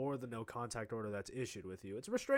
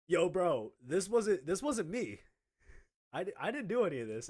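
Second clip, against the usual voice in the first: louder, higher urgent denial; insistent, pitched-up protest; protesting innocence; 5 semitones higher, 4 dB louder than usual. Retold or imitated. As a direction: plead your case to the dean. Pleads innocence to the dean.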